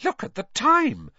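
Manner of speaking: a mother's sudden alarm. A narrator's reading. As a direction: burst out as if just noticing. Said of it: "Missus Weasley said suddenly".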